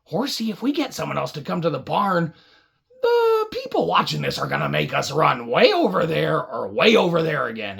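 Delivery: ordinary delivery, nothing marked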